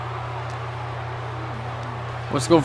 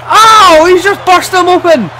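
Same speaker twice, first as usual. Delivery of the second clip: sudden excited yell, higher; 8 semitones higher than usual. Acted. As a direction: shout it out at the big hit. Big move in live commentary.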